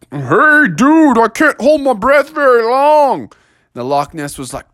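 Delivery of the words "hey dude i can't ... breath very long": high, slow, worried little bear; a young bear running out of air. Acted. Bear talks underwater.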